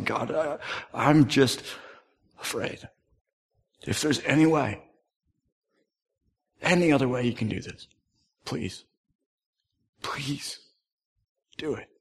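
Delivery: soft, broken, pleading prayer; fearful prayer. Acted. Jesus praying to God in fear, softly pleading. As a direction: beg God quietly to spare him.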